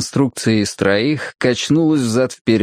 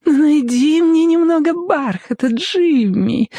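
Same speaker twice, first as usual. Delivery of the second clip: put-on very high wheedling little-girl plea; like a child begging for candy; fake-sweet voice; slower than usual. A narrator's reading. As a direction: coax him with sugary pretence. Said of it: "попросила она притворно сладким голосом".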